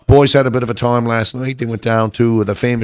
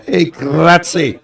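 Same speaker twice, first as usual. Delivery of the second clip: calling out grazie with a long rolled R; mock yell; 5 semitones higher, much slower than usual. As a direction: hail a friend down the road, drawn out and higher. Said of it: "someone yells out".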